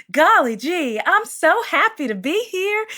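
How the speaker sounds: high, sweet Southern princess voice; chirpy country-accented princess. Shown as a demonstration. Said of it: "a country accent".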